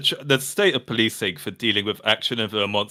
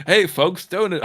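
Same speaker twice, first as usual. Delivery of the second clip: mock YouTuber intro; 6 semitones higher than usual. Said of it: "Go full donut operator".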